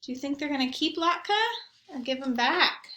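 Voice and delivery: usual speaking voice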